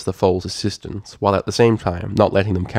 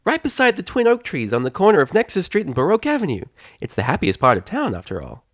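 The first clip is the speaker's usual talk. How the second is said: exclaimed; 9 semitones higher than usual. Acted. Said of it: "she pointed and exclaimed".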